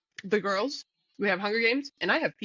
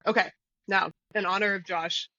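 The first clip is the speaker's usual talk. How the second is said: ordinary talk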